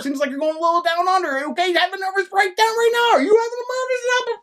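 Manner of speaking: high, agitated rant building to a near scream; heated meltdown. Real, not acted. Haranguing the bored co-host about classic films, frantic meltdown. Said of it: "this is gonna be a very calm video".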